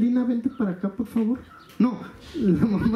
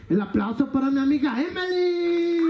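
higher-pitched call with a long held final name; announcer's applause call; 4 semitones higher than usual. Acted. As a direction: hold the last name out like a show host. Host redoes the applause call.

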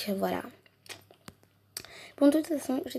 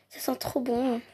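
ordinary delivery

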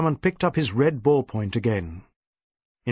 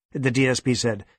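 plain reading voice

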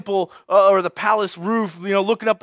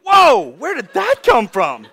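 loud, high whoa, then slower; startled reaction; 4 semitones higher, 6 dB louder, slower than usual. Acted. Bystander reacts to someone's sudden blow-up, startled. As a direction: recoil in mock astonishment.

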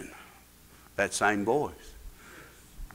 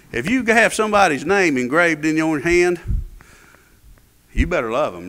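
ordinary talk, nothing marked

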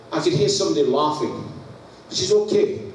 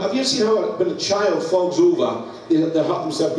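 ordinary talk, nothing marked

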